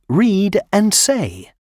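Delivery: usual speaking voice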